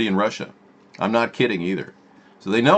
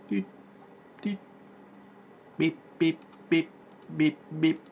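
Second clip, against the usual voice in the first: computer beeps; 6 semitones higher, 7 dB quieter than usual. Pretend. Imitating computer noises.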